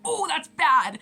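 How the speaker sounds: high, loud ooh of alarm; mock dismay. Student hearing she may be kicked out, mock alarm. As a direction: squeal out the bad news.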